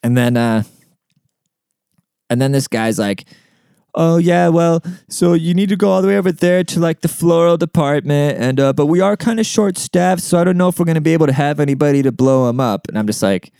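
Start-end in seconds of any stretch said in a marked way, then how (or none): 3.9-12.9 higher-pitched voice imitating an apologetic employee; hapless store worker. Store worker explains they are short-staffed, high mocking imitation. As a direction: put on a high, rambling clerk voice.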